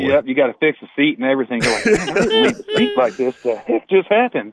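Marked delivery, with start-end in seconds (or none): 1.9-4.5 much higher, laughing, puzzled voice; mock baffled. Angler finding his truck seat moved: laughing mock confusion. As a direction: play it baffled through a laugh, slowing at the end.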